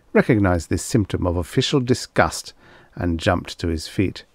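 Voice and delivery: ordinary delivery, nothing marked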